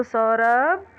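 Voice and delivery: सौरभ called out, the आ dragged long; calling out a name. Shown as a demonstration. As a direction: call सौरभ across the room, stretching the vowel. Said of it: "आ को आपने लंबा खींचा". (सौरभ को पुकारते हुए, आ लंबा खींचकर)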